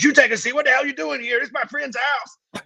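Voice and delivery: high, indignant neighbor yelling back; high-pitched outraged homeowner, slowing at the end; angry neighbor. Retold or imitated. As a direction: turn the tables, outraged and high. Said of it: "And he goes".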